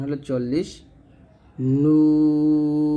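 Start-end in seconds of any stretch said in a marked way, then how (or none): 1.8-2.9 নুন held long and louder in recitation tone; Arabic letter nun. Shown as a demonstration. Teacher demonstrating নুন to students, drawn out. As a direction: stretch নুন out nasally held. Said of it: "নুন এর গাণিতিক মান".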